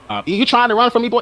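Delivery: hammed-up Southern officer voice; mock Southern cop. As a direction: play a Southern policeman stopping him. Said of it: "Now he's from the South".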